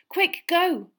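loud, high push to leave now; urgent shout. Acted. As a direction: shove him off with your voice. Hurrying Sirius to flee.

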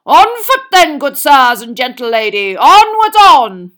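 rally them onwards, drawing out the end; crying out. A narrator's reading.

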